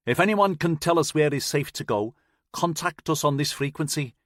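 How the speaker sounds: Scouse distress call on repeat; Liverpool accent. Acted. Ship crew broadcasting for help, light Liverpool accent. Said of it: "came the Liverpool accent over the radio".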